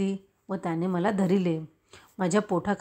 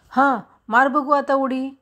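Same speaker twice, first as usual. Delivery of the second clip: urging call; 7 semitones higher, 6 dB louder than usual. A narrator's reading. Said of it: "Balu म्हणाला".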